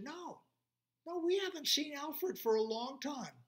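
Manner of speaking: high-pitched little voices; little animals. Acted. Little animals telling the lion no, high voices. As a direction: answer like tiny eager creatures.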